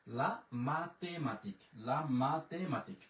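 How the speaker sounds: লা মাতেমাতিক said slowly and clearly twice; slow model pronunciation. Shown as a demonstration. Teacher asks students to listen by ear, slow demo. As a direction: let them hear it, unhurried. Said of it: "মুখের উচ্চারণটা কানে শোনা".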